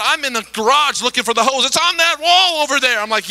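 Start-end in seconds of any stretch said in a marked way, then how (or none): 1.6-3.0 high, loud order; bossy shout. Retold or imitated. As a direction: point and command. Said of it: "bossing us around".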